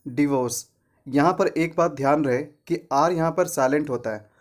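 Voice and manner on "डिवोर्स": डिवोर्स with short "di"; short i opening डिवोर्स; correct pronunciation. Shown as a demonstration. Teacher repeats for learners, डिवोर्स as "di-vorce". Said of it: "क्या होता है".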